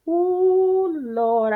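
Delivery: a high, squealing "ooh, Lord"; shocked. Retold or imitated. Seeing her swollen lips in the mirror, high shocked cry. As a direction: shriek it in dismay.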